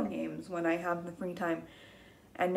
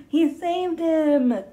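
gasp, then high slow amazed line; 8 semitones higher, 6 dB louder, much slower than usual. Acted. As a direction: marvel at it for the kids.

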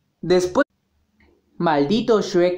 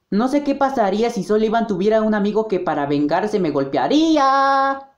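usual speaking voice

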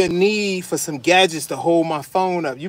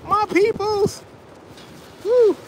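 excited shout-out; slower than usual. Real, not acted. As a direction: call out to your people with joy. Greeting his home neighborhood.

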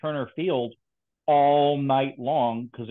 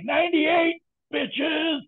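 high, gloating mock victory shout; mock triumphant yell; much slower than usual. Teasing a friend about his faked temperature, high gloating shout. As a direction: crow it like you won. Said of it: "you're like".

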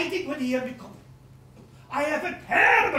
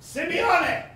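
ordinary talk, nothing marked

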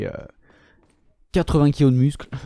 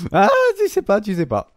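much higher, playful taunting; teasing sing-song; 6 semitones higher than usual. Pretend. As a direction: tease the viewers with a squeaky lilt. Teasing viewers about his looks.

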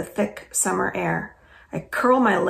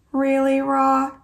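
exasperated; 7 semitones higher, 4 dB louder, slower than usual. Acted. Friend exasperated, face palms.

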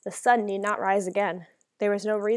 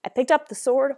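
usual speaking voice

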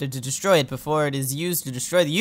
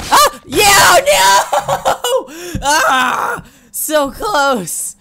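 high laughing yell of near-triumph; laughing shout; 13 semitones higher, 6 dB louder, much slower than usual. Real, not acted. Gamer nearly wins the level, laughing and yelling. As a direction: crack up and shout as it almost works.

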